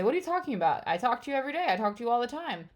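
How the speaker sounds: high-pitched protesting retort; re-enacted indignant denial; defensive. Acting out defensive reply to partner.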